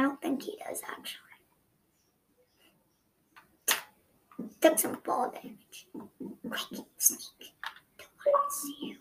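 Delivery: tense hushed whisper; whispering while hiding. Real, not acted. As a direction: keep it to a whisper. Hiding from enemy in game.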